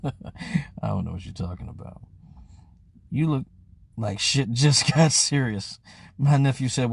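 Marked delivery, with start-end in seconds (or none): 0.0-2.0 much lower and softer, a laughing seductive vampire purr; Vampire in Brooklyn seduction. Pretend. Cohost's coy denial about the sex face, low vampire purr. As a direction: drop low and soft, play coy like a movie vampire. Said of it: "Didn't he sound like the Vampire in Brooklyn?".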